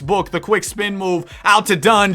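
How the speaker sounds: live sports commentary, rising with the action; energetic announcer call; play-by-play. Acted. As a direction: call the game like a broadcaster. Live play-by-play of game sim.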